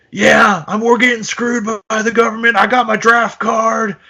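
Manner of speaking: hammed-up blue-collar shout, strained high; blue-collar rocker. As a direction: belt it out like an aggrieved rock singer. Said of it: "blue collar way of being".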